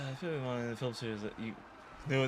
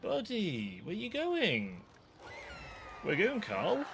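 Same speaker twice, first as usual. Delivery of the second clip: taunting villain; 9 semitones higher than usual. Pretend. Taunting fleeing enemy Carl.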